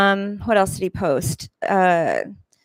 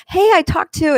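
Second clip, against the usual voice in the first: retold friendly greeting; 11 semitones higher, 5 dB louder than usual.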